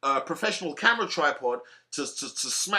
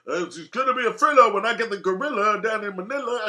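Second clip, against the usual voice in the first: higher, louder boastful Ali rhyme; a lightly voiced Ali pre-fight boast; Muhammad Ali goading; 5 semitones higher, 5 dB louder than usual. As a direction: hype it up like Ali taunting a rival. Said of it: "Muhammad Ali did it best".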